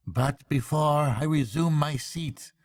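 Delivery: a lightly voiced after-dinner orator; pompous speechmaker. A narrator's reading. Chief Weasel toasting the banquet guests, lightly pompous speech. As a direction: play the smug toastmaster, lightly.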